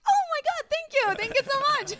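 thrilled cyclist thanking the church lady for a bed; overjoyed thanks. Retold or imitated. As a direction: gush with relief and delight, fast. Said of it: "And I'm like".

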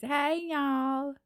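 high, stretched womanly Southern greeting; high Southern drawl, long vowels; feminine Southern accent. Shown as a demonstration. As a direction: demonstrate a Southern woman's hello. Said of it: "kind of a woman way of saying it".

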